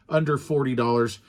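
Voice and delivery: ordinary talk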